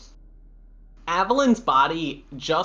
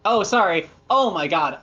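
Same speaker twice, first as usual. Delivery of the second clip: usual speaking voice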